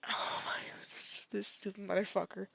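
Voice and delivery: whispered, stunned disgust; appalled whisper. Real, not acted. Reacting to the dog quote, appalled whisper.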